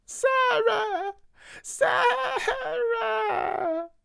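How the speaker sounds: very high wailing cry for Sarah; crying call. A narrator's reading. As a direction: call out through tears like a small child. Crying child calls for Sarah.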